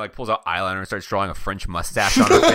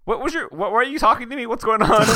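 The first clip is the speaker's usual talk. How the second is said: very high, comically confused voice; high-pitched bewilderment; 12 semitones higher, 3 dB louder, faster than usual. Acted. Character baffled at being talked to, high comic confusion. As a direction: squeak it out, close to laughing.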